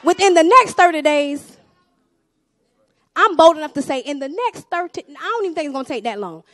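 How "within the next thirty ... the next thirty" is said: preaching declaration. Acted. Bold declaration.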